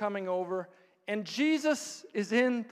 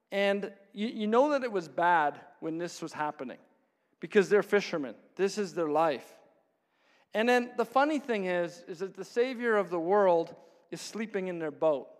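usual speaking voice

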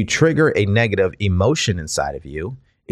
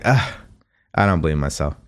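doubtful groan; 6 semitones lower, 4 dB quieter, faster than usual. Retold or imitated. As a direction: lower and softer, a reluctant ugh of disbelief. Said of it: "'Cause when you say it, you're like".

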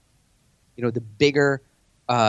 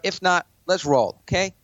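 higher and pushy, jabbing questions; aggressive challenge; 4 semitones higher than usual. Shown as a demonstration. To a billionaire in a pitch meeting, a pushy challenge. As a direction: corner them and demand an answer. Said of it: "pointing at a billionaire".

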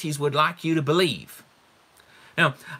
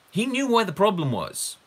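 usual speaking voice

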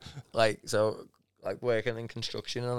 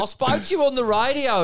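squeaky high protest; high mock voice; 14 semitones higher, 7 dB louder than usual. Worker insisting to the boss, mock high voice. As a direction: voice jumps high, louder. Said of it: "being like".

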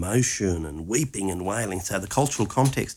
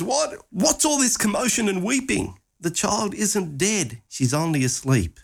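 indignant Jesus; 10 semitones higher than usual. Acted. Jesus rebuking mourners.

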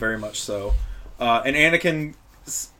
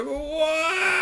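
high, drawn-out mock wail; mock anguished yell; 4 dB quieter than usual. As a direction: cry it out in hammed-up anguish. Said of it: "And he yells".

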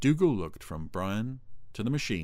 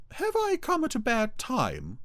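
very high falsetto question; theatrical high-pitched lady cow; fluting Ermintrude; 3 dB louder than usual. A narrator's reading. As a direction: ask it sweetly, way up high. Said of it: "I want to do the voice".

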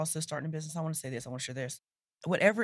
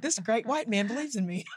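amazed disbelief; 5 semitones higher than usual. Retold or imitated. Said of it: "I was like".

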